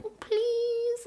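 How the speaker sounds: high, whiny baby-voiced begging; whiny pleading. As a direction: wheedle in a mock whine to get your way. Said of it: "whiny voice".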